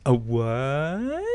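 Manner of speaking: quick imitation of a puzzled a what; lightly voiced, rising a what; imitating the deadpan delivery. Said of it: "you couldn't replicate that".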